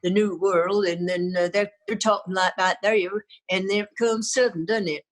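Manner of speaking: Bristol accent drifting toward American Southern; broad West Country English with heavy Rs. Shown as a demonstration. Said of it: "the West Country, they all talk like that".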